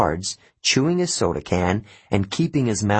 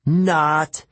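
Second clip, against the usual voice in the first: short, higher, mildly raised yell; 7 semitones higher, 4 dB louder than usual. A narrator's reading. Boy waits for somebody to shout it, restrained yell. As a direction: call it out higher, a touch louder. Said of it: "waited for somebody to yell".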